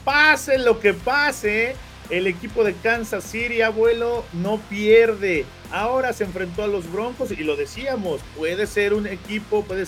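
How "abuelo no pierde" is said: ordinary talk, nothing marked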